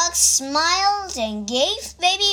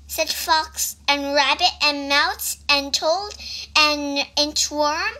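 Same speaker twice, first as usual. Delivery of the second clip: ordinary delivery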